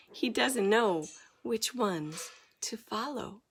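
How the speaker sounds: ordinary delivery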